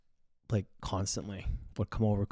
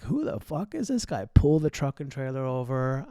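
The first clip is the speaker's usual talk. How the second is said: retold angry outburst; 5 semitones higher, 3 dB louder than usual. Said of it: "And I'm like".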